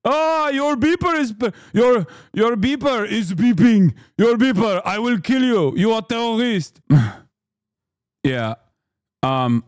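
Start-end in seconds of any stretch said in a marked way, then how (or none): 0.1-6.8 put-on high, threatening Israeli-accent rant; mock Israeli accent. Israel defender threatening a supposed terrorist, in a high mock Israeli accent. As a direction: stammer into an accusing, cartoonish Israeli accent. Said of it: "please stop with this accent".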